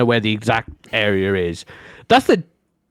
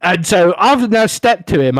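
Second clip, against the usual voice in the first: usual speaking voice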